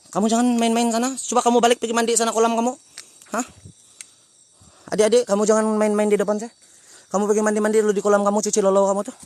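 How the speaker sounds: loud, high telling-off; scolding shout. Real, not acted. Hiker ordering spirit kids back to their pool, loud scolding. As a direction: order them away like a stern elder.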